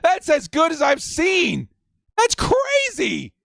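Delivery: high shouted amazement that slows at the end; hyped shout. Real, not acted. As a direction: shout your disbelief at the highlights. Hyped watching player highlights.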